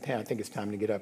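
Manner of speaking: sleepy quiet. Retold or imitated. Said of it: "first I touched Pam and said".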